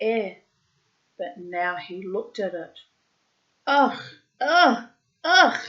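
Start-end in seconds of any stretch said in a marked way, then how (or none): 3.7-5.6 loud grunting, pitched up; panicked animal grunts; strange grunts. A narrator's reading. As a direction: grunt out of sheer fright. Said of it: "Strange grunting noises".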